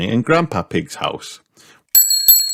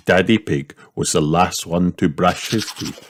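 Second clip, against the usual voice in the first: ordinary delivery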